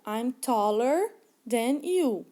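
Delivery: very slow, carefully spelled-out English for learners; slow model sentence. Shown as a demonstration. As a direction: read it slowly like a teacher modeling. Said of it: "o exemplo ficou". (frase em inglês lenta e bem articulada)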